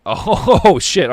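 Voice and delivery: loud, high drawn-out oh with a laugh; startled outburst. Real, not acted. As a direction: burst out, caught off guard and laughing. Caught off guard by the rapper.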